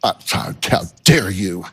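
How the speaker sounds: mock-offended huff, low at the end; indignant attitude, imitated. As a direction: put on a touch of haughty outrage. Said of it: "this attitude like".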